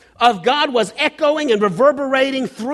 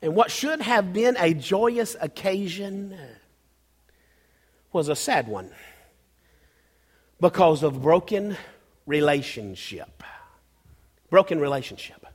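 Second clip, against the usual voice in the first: solemn sorrow; 4 semitones lower, 6 dB quieter than usual. Real, not acted. Preacher grieving a broken relationship with God, solemn. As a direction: let it sink low and heavy.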